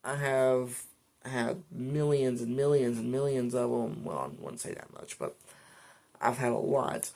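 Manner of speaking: normal talking voice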